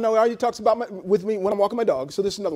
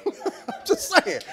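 laughing; 8 semitones higher, 6 dB quieter, much slower than usual. Real, not acted. Laughs at own jab.

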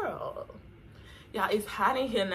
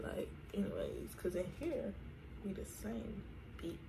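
whispered, much softer; whispered aside; 13 dB quieter than usual. Real, not acted. Vlogger whispering a private aside to viewers.

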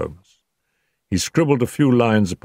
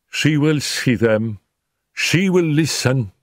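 slow, measured maid voice, raised in pitch; maid's solemn announcement; 7 semitones higher, slower than usual. A narrator's reading. Maid admits visitors to her mistress, solemnly. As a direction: announce it word by word, higher and slow.

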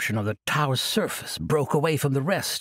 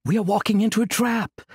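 desperate; 8 semitones higher, 5 dB louder than usual. Acted. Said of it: "looking desperately".

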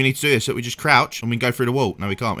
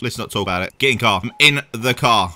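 normal talking voice

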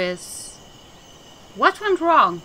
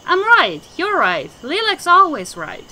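gleeful boast; 7 semitones higher, 6 dB louder than usual. Acted. Madman cheering himself on.

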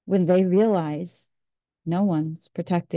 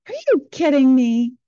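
put-on higher, louder indignant teen outburst; voicing the angry thirteen-year-old, higher and louder; mad teen; 6 semitones higher, 7 dB louder than usual. Mom retells her teen's outburst over stolen cookies, indignant and higher. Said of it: "Thirteen-year-old got mad".

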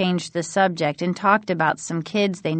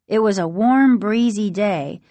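ordinary delivery, nothing marked

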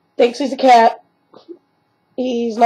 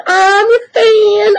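mock Grumpy Cat; 9 semitones higher, much slower than usual. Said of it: "Grumpy Cat says".

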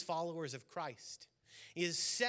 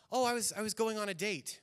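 lightly raised mock woman's voice; man playing a woman; 6 semitones higher, 5 dB louder than usual. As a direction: play the wife, a bit higher and airy. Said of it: "himself playing a woman".

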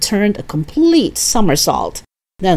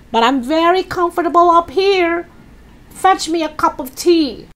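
high-pitched bossy grandma voice; demanding old Grandma; 12 semitones higher than usual. Acted. Grandma tells George to fetch tea, bossy and high.

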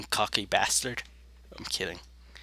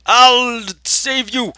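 high, loud, slow drawn-out shout; hammed-up rescue cry; 11 semitones higher, 9 dB louder, much slower than usual. Player rushing to save teammate, heroic shout. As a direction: draw it out like a heroic call.

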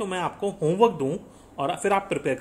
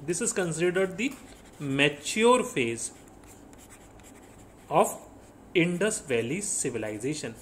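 slow dictation; much slower than usual. Real, not acted. Teacher slowly dictates the definition.

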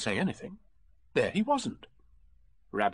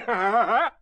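loud, high character laugh; Tigger's laugh; 11 semitones higher, 8 dB louder than usual. A narrator's reading. Tigger puzzled by vanished friends, laughing loudly.

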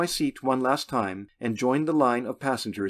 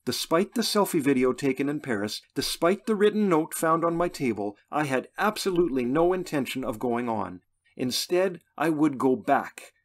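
ordinary delivery, nothing marked

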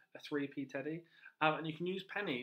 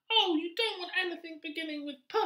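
high, fast, loud rat; rat character; 8 dB louder than usual. Acted. Teacher voicing the rat brushing off the P animals, high and fast. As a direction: rattle it off as the eager rat.

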